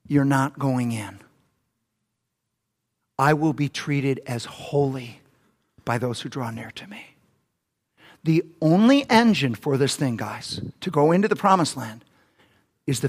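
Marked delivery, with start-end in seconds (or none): 0.1-7.1 solemn voice of God. Pretend. God's verdict to Moses and Aaron.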